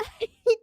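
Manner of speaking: high, loud broken laugh; a choked laugh breaking out; high tearful laugh. Real, not acted. Reactor laugh-crying at the ending, high tearful laugh.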